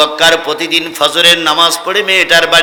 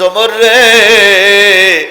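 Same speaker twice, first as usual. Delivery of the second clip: loud call with a long drawn-out ending; slow, plaintive chanted call; imploring call; 3 semitones higher, 5 dB louder, much slower than usual. Acted. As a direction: call out to him, stretching the last vowel. Abu Bakr calls imploringly to Omar.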